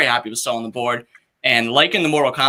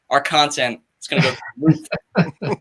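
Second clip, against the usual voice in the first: CEO voice. Acted. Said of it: "my C E O voice".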